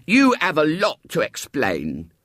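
slow, stern scolding tone. A narrator's reading. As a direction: make her feel the blame. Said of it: "she said sternly".

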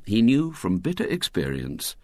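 plain reading voice

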